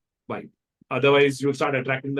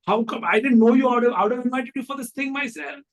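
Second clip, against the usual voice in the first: high, surprised retold exclamation, then a louder line; mock surprise; 10 semitones higher, 4 dB louder than usual. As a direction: act out the host's amazed embarrassment. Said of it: "And then she went".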